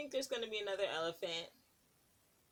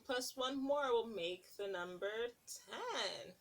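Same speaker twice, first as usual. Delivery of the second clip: put-on lilting read-aloud to little kids; sing-song picture book narration; storybook reading; 3 semitones higher than usual. Reading a picture book to children, lilting.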